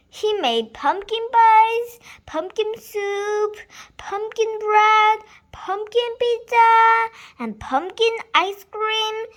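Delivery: high loud gleeful list; excited list. A narrator's reading. Reading the Halloween feast to a child, excitedly. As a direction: pile up the treats with glee.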